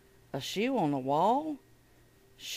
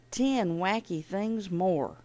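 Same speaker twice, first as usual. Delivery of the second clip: ordinary delivery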